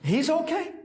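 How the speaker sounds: high questioning "He's okay?"; acted outraged disbelief; incredulous echo. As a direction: throw it back as a disbelieving question. Client echoes it in disbelief.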